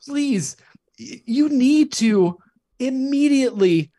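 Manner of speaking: eager plea. Real, not acted. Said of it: "is that a thing? please let me know".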